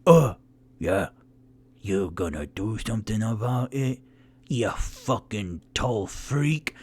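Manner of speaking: low, challenging dwarf voice; nonplussed dwarf. Acted. Thornan challenging Onyx who grabs his arm, low and nonplussed. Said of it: "completely nonplussed".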